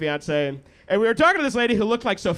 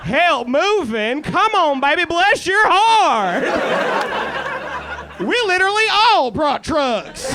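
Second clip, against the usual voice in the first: hammed-up Alabama drawl, high and slow; Montgomery, Alabama locals; 8 semitones higher, 4 dB louder, slower than usual. Alabama crowd offering to help him move out, mock Southern drawl. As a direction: holler like eager Alabama townsfolk. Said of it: "the entire city of Montgomery".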